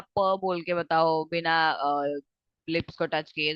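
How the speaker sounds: ordinary talk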